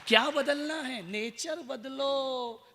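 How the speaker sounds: drawn out, high, each word weighed; slow emphatic slogan. Acted. Preacher stretching a slogan to the crowd, slow and high.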